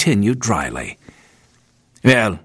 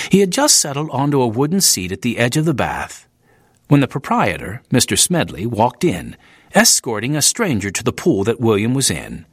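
ordinary delivery

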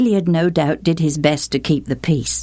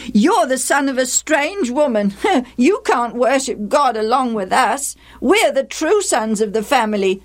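high, taunting dismissal; scornful boys; 10 semitones higher than usual. A narrator's reading. Half-brothers leaving Jephthah behind, scornful and taunting. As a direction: shut him out with mocking scorn.

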